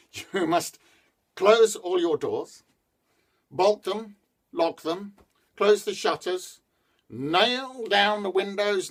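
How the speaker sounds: high, loud hurried instructions; holy man. A narrator's reading. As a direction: order the family to lock up. Holy man gives orders.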